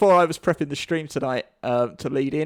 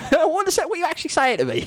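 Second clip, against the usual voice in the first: high-pitched incredulous questioning; pitched-up mock outrage; comic exasperation; 11 semitones higher than usual. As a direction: demand an answer, baffled and high. Rant at a redundant saying.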